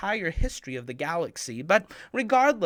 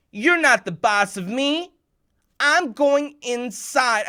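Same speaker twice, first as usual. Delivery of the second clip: louder, slow and defiant; defiant brother; 7 semitones higher, 9 dB louder, slower than usual. Retold or imitated. Brother refuses to share the bat, slow, loud defiance. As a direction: dig in and refuse.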